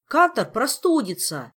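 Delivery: high, loud, worried protest; anxious explaining. A narrator's reading. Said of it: "пояснил Жак".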